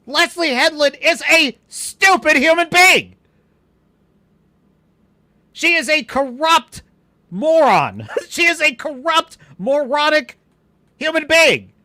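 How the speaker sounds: high, loud, half-laughing insults; loud mocking rant. Real, not acted. Streamer mocking a showrunner, high loud laughing rant. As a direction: hurl the insults with glee.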